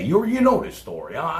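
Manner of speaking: ordinary talk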